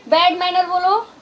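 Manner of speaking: high, loud sing-song prompt; coaxing child to repeat. Shown as a demonstration. Mother prompts toddler to repeat the phrase, high sing-song. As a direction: coax the baby to copy the words. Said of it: "जब मैं बोलूँगी bad manner ना".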